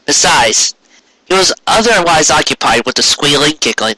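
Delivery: usual speaking voice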